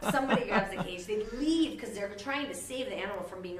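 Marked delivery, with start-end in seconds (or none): 0.1-0.1 very high, loud squeal through laughter; cracking up at the absurdity; laughing disbelief. Retold or imitated. Elphaba asks Fiyero, squealing with laughter. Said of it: "Elf was like".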